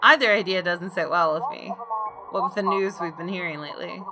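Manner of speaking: usual speaking voice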